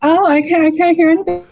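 high, fast, frantic little voice; panicked contestant quote. Retold or imitated. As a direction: squeak it out in a rush, panicking. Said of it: "And I was kind of like".

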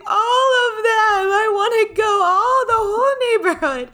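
put-on high wailing child tantrum; crying tantrum. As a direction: wail it like a kid. Child throwing a fit.

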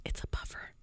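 hushed whisper. Retold or imitated. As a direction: whisper it like a secret. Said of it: "she goes".

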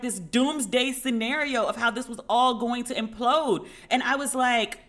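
usual speaking voice

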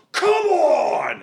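high exasperated shout; frantic waiter's cry; harried shout. Acted. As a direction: yell it like a fed-up waiter. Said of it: "the harried waiter is like".

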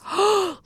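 high, sharp intake of breath; shocked gasp. Real, not acted. Podcast host to co-host who doesn't know a celebrity, shocked gasp. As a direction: gasp in disbelief.

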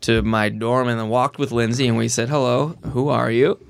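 ordinary talk

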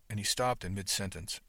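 ordinary delivery

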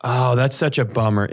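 ordinary talk

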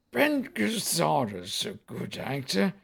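slow, soft, drawn-out alien voice; lightly voiced James Mason; James Mason impression. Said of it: "do the punchline as James Mason".

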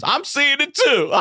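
very high mock-frantic woman patient; cartoonishly high, wild outburst; hysterical neighbor. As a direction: squeal it like a fellow hallucinating patient. Acts out neighbor behind the curtain.

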